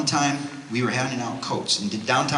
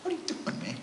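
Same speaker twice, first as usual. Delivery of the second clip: mock disbelief, hushed and squeaky; imitated bewilderment; 10 dB quieter than usual. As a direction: act out a baffled guy under his breath. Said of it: "The guy looks at him like".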